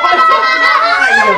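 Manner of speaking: high laughing yelp; laughing shout. Real, not acted. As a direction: burst out laughing. Bursts out laughing. (teriak sambil tertawa)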